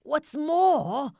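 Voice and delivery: high loud snap; loud high angry snap; snapped. A narrator's reading. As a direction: snap at him sharply. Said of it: "snapped the Lorax".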